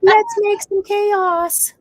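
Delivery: loud, high, drawn-out playful call; rousing the dogs. Real, not acted. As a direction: egg the dogs on slowly and loudly. Egging on the dogs.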